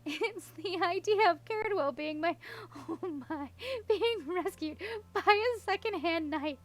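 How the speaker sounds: breathless laughing words, high; gasping with laughter. A narrator's reading. Princess explaining the joke, gasping with laughter. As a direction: fight through the giggles to get it out. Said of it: "Eleanor gasped".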